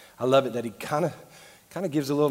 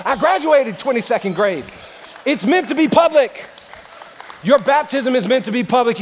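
loud, higher-pitched pulpit preaching; raised voice, driving the point home; full-force preaching; 8 semitones higher, 9 dB louder than usual. Acted. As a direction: preach it hard to a big congregation.

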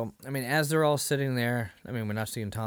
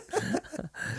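short high-pitched laugh; 4 dB quieter than usual. Real, not acted. Laughing at the ramp joke, high giggle.

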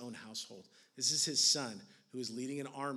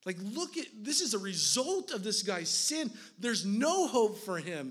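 loud, high, driving sermon voice; impassioned preacher pleading for a drowning king; preaching at full force; 8 semitones higher, 7 dB louder than usual. Acted. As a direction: preach it hard, pressing every line.

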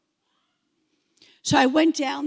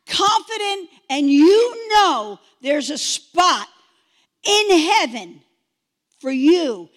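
preacher; 4 semitones higher, 4 dB louder than usual. Retold or imitated. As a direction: thunder it like a revival preacher. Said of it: "He says this statement".

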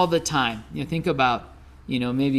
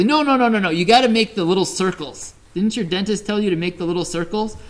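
higher, louder busybody roommate; mock bossy correction; 5 semitones higher, 4 dB louder, faster than usual. Roommate correcting his brushing, mock bossy and insistent. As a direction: imitate a know-it-all correcting someone's technique. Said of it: "Chip would give me pointers on this".

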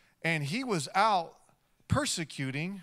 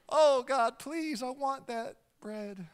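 pleading; 8 semitones higher, slower than usual. Acted. Begging for the bread.

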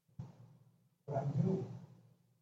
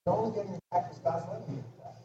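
ordinary delivery

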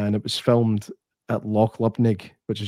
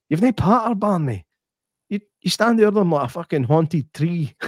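higher mock Glasgow put-down; a hint of a scornful boss; boss firing Barney; 7 semitones higher than usual. Son of the owner firing Barney, lightly voiced scorn. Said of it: "he's like".

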